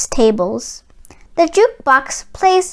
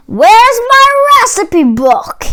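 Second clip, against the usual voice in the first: louder, higher angry giant demand; loud angry giant growl; 8 semitones higher, 6 dB louder than usual. Acted. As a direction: bark for the missing book. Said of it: "he growled".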